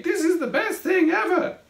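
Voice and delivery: very high, loud, thrilled squeal; squeaky excitement. A narrator's reading. Craig rolling in the bottle, squeaking with glee. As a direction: squeak it like a thrilled little creature. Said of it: "squeaked Craig".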